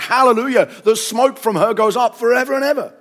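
loud, high jubilant proclamation; crying out. A narrator's reading. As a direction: cry praise out like a whole crowd. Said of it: "Once more they cried out".

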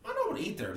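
hammed-up fake crying; mock crying whine. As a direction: wail it like a spoiled kid. Mock whining shout.

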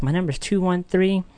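teasing. Retold or imitated. Girl brushing off the boy asking for her number, teasing.

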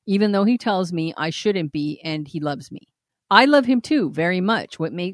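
normal talking voice

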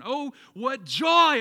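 loud, slow "Oh, what joy"; joyful proclamation. Acted. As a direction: proclaim it like good news, drawn out. Preacher exclaims scripture joy.

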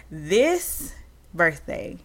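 ordinary talk

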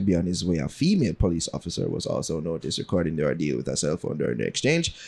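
low-pitched, measured news reading. Real, not acted. Reads out the news story.

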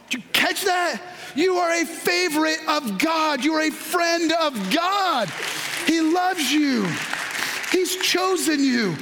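high, ringing declarations with drawn-out key words; impassioned preaching. Acted. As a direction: proclaim it to the whole congregation. Preaches to the congregation.

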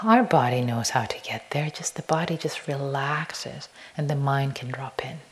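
a soft, breathy hush; whispered, calming guidance; hushed, soothing. Real, not acted. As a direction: ease them into relaxation with a near-whisper.

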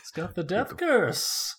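slow, high ominous warning; Crazy Ralph. Retold or imitated. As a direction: croak out the curse. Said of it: "Crazy Ralph".

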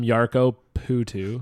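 Jarkko Putu said slowly as a joke nickname; mocking name twist. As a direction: drawl out Putu like a playground taunt. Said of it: "Or as I called him".

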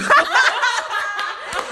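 high, helpless cackling laugh; real burst of laughter. Real, not acted. Listener hears he turned into a wolf, high laughter. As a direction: burst out laughing at an absurd twist.

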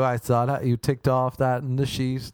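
usual speaking voice

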